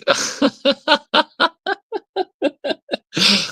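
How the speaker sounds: long high burst of laughter; helpless real laughter; big laugh. Real, not acted. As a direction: crack up at the chat for real. Streamer cracking up at chat.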